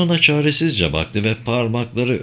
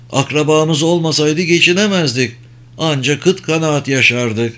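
higher, nervous country farmer voice; anxious farmer; 6 semitones higher, 3 dB louder than usual. Acted. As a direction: voice a worried old farmer pleading. Farmer Arbin's voice continues. (endişeli, tiz sesli çiftçi)

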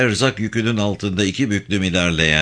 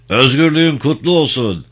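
raised, high parade-ground call; military salute; 5 semitones higher, 4 dB louder than usual. A narrator's reading. Commander saluting his assembled guerrillas, calling out. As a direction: call it out to the ranks.

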